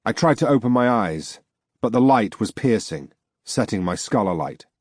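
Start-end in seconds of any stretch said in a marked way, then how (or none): none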